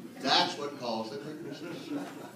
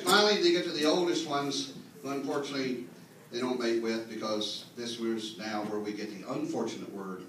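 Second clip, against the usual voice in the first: usual speaking voice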